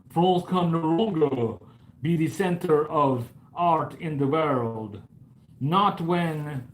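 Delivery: a rough mock French accent that slides into Russian; hammed-up French accent turning Russian by the end; mock French accent, slipping into Russian; French accent drifting to Russian. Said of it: "I don't know what kind of accent that was".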